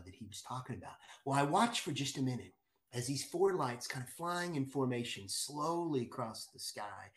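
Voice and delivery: normal talking voice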